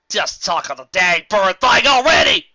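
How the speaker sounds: loud, slow, country snap; impatient snap. Acted. Applejack snaps at Rarity to get on with it, loud. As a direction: bark it out impatiently.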